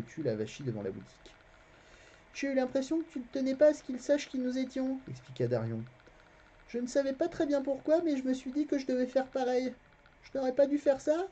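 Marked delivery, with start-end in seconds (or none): 2.3-11.3 put-on a boy's voice, pitched higher; the narrator voicing the boy Garion, higher; boy lying innocently to a stranger, higher voice; young boy Garion. A narrator's reading.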